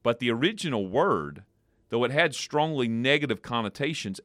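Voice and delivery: normal talking voice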